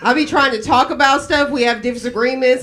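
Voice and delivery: ordinary talk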